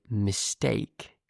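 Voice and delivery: standard British "mistake", second vowel gliding from "eh" to "ee"; standard British accent. Shown as a demonstration. Teacher models it for English learners, standard British pronunciation. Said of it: "use the standard British pronunciation".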